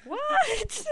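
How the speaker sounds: very high, mock-incredulous squeal; baffled reaction. Viewer reacting to a weird scene, high mock disbelief. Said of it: "And I'm always like".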